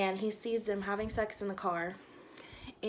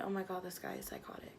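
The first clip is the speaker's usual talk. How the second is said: hushed inner thought; 11 dB quieter, faster than usual. Retold or imitated. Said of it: "I'm thinking in my head".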